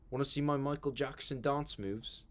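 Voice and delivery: put-on a light New Zealand accent, quoting Boy fondly; New Zealand accent quote. Quoting a favourite line from Boy in a light New Zealand accent. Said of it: "I just wanna quote Boy all day".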